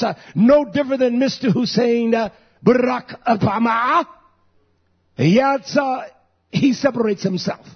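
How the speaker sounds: loud, driving pulpit delivery; fired-up preaching. Real, not acted. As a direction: drive each phrase home to the congregation. Preaches at full force.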